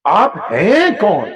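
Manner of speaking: drawn-out, wondering who are you; slow puzzled question. Retold or imitated. The stranger slowly asks his helper who he is. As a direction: ask آپ ہیں کون slowly, drawing out ہیں.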